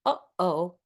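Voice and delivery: uh-oh with a clean catch in the throat; glottal stop demo. Shown as a demonstration. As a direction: close the glottis between uh and oh. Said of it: "if you close the glottis".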